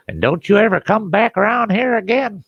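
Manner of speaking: high-pitched, scolding holler; mock furious mama, voice way up; imitated mother yelling. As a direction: holler a warning after the daughter. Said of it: "her mother's yelling at her".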